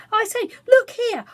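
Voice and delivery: high loud call; excited cry. A narrator's reading. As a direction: call out waving the paper.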